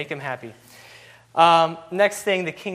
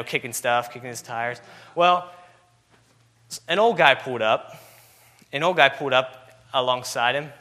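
ordinary talk, nothing marked

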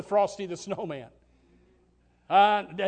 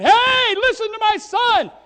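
much higher and louder call; mock booming God; 13 semitones higher, 10 dB louder than usual. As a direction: bellow it from the sky as a contrast. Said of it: "and said".

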